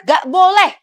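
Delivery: loud, high-pitched scolding no; stern refusal. Acted. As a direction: shut the child down firmly. Mother refuses child food.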